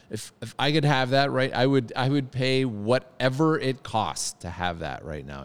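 really mean it, you'd pay anything; emphatic wish. Real, not acted. Restarts wish with rising passion.

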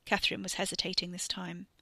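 ordinary delivery, nothing marked

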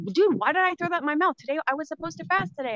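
high, fast exasperated scolding of herself; self-scolding. Retold or imitated. Caller mocks herself for breaking the fast, high fast exasperation. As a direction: rush it out, annoyed at yourself.

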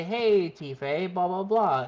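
normal talking voice

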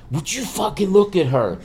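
high-pitched retold fight hype; hyped-up screaming fan. Friend hyping Rousey before the fight, excited. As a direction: imitate a fan getting worked up. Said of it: "they were screaming".

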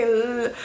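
"L-" as one sustained L; held L sound. Shown as a demonstration. Showing what slips out when she's talking, held L. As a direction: let the L of "L-" hum on the tongue. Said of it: "I need to say".

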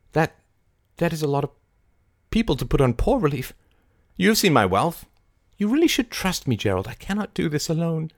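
high-pitched woman's character voice; high, pleading persuasion; Mary, persuasive. A narrator's reading. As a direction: coax him with earnest insistence.

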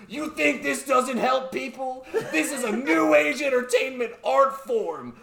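high, laughing, worked-up defense; laughing rant. Retold or imitated. Kid ranting at the scientist, high and laughing. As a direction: goes off on him, high and laughing. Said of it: "he's like".

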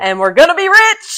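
high, loud joking cheer; shrieking jackpot winner. Pretend. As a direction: squeal like you just won big. Celebrating new job.

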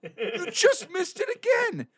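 very high, drawn-out exasperated cry; mock outrage. Scolding Brian for missing it again, high mock outrage. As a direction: squeal it slowly in mock disbelief.

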